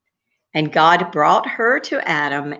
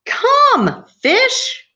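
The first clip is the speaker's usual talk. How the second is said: very high, loud summons; calling out to creation; 4 dB louder than usual. Acted. God summons the fish, high loud call.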